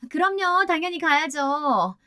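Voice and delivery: high, drawn-out eager agreement; sugary yes to a superior; eager fawning. A narrator's reading. Fawning wife agrees to help superior.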